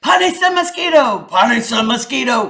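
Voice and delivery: loud chanting demand; crowd cries out. Acted. Animals demand punishment, loud chant. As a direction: shout it like an angry crowd. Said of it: "cried all the animals".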